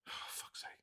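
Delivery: whispered, weary curse; exasperated mutter. Real, not acted. Despairing at the state of politics, whispered exasperation. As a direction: sigh it out barely audibly.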